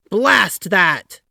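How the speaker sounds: loud, heavy "Blast that"; bellowing curse. A narrator's reading. Taft bellowing at the eager vice president. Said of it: "bellowed Taft".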